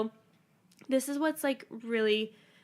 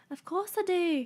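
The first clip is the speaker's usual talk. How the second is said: higher-pitched, lilting British audiobook voice; British accent imitation; 7 semitones higher than usual. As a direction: mimic the British narrator, pitched up. Mimicking the British audiobook voice just played.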